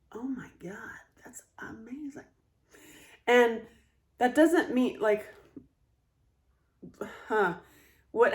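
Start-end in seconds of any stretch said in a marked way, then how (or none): 0.1-2.2 breathless unvoiced whisper of wonder; hushed, awed whisper; amazed whisper. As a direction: whisper it like you can't believe it. Amazed reaction quoted.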